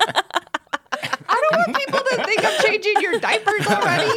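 real laughter while teasing; laughing. Real, not acted. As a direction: laugh the words out. Laughing at husband's age joke.

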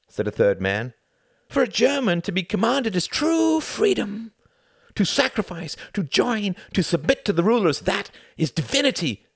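lifted, rapturous believer; zealous German drinker. A narrator's reading. Third man lecturing Tom, rapturous and zealous. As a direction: preach obedience like a faith.

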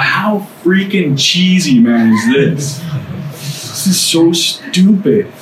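drawn-out, scornful put-on voice; scoffing inner voice. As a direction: sneer it slowly at something cheesy. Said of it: "Who's that talking, man?".

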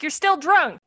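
high, loud scolding wife; nagging wife. Retold or imitated. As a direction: snap it like a fed-up wife. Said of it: "his wife's like".

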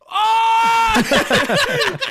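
high, loud frightened yelp; mock kid's shriek of fright; terrified scream. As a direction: scream like a scared child at the movies. Scared kid at loud movie.

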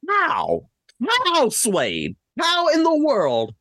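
high, sharp disbelief on how; a real stunned how; incredulous outburst. Real, not acted. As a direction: demand an answer, stunned. Outraged rant at cohost.

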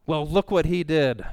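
mock self-justification, pointing the finger; self-justifying retort. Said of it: "quick to justify it and say".

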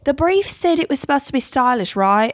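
high-pitched catty British dig; passive-aggressive talking-head critique; bitchy British contestant. Acted. Design show contestant judging a rival, bitchy. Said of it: "little bitchiest little twink".

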